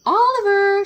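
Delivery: high, loud call across the garden. Acted. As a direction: call the cat from the back door. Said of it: "she called".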